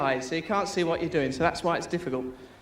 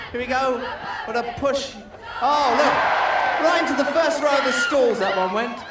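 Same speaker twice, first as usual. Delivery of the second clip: live play-by-play; 4 semitones higher than usual. Acted. Host commentating Jim's blindfolded swings, excited play-by-play. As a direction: call every swing as it happens.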